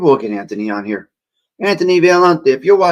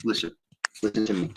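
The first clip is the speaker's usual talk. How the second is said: softer, mic pulled back; away from the mic; 4 semitones lower, 9 dB quieter, faster than usual. Shown as a demonstration. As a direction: say it with the microphone held away. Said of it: "hear the difference as I move the microphone".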